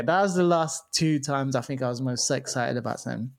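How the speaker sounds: usual speaking voice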